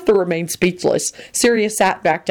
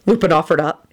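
usual speaking voice

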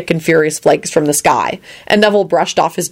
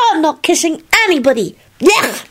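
put-on high-pitched disgusted child; acted squeamish protest; Teddy, horrified; 12 semitones higher than usual. A narrator's reading. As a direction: recoil like a grossed-out little boy. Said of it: "replied with a horrified face".

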